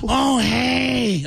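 high impression of a comedian's greeting; Louie Anderson. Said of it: "when you do that over and over again".

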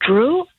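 "Drew" with a high questioning rise. Real, not acted. Caller asked where she met Drew, questioning rise. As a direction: lift "Drew" like an unsure question. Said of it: "Did you say that as a question?".

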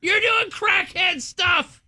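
high-pitched, drawn-out mock scream; imitated screaming. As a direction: imitate a shrieking sister-in-law in the yard. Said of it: "I just hear her screaming".

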